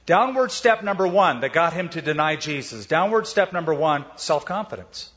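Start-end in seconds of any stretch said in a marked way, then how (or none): none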